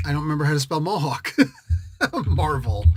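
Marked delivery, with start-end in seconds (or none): 1.4-2.2 short breathy laugh. Real, not acted. Laughs at own forgetfulness.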